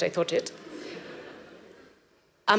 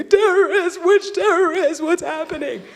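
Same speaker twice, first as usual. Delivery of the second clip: high, loud frightened cries; panicked questions; 9 semitones higher, 6 dB louder than usual. Retold or imitated. Strangers on the street, crying out in fear. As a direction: grab him and beg in panic.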